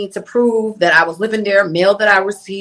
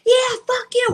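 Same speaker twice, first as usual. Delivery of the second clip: much higher, defiant snap; defiant retort. Retold or imitated. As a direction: fling it back at him in spite. She curses him, giving finger.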